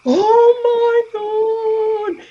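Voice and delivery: high, loud, long drawn-out shriek of joy; excited scream. Retold or imitated. Drive-through worker screaming on recognizing the vlogger, a long high shriek. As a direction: scream it out like a starstruck fan. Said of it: "And she was screaming".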